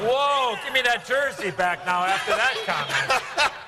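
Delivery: high, slow, loud indignation; drawn-out, high-pitched objection; mock outrage. Real, not acted. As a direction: protest loudly through amusement.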